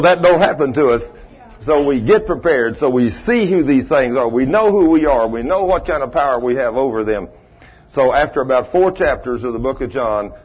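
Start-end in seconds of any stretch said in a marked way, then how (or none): none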